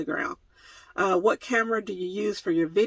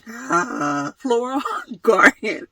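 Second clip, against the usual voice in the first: laughing through it; 5 semitones higher, 4 dB louder, much slower than usual. Real, not acted. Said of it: "I cannot pronounce the word".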